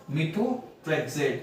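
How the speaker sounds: methotrexate drawn out very slowly, part by part; very slow spelled-out. Shown as a demonstration. Teacher showing students how to split the drug name. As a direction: stretch methotrexate out syllable by syllable. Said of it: "क्या हो गया?".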